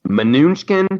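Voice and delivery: "Mnuchin" said muh-NOON-skin; variant name pronunciation. Shown as a demonstration. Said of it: "pronounce his name a different way".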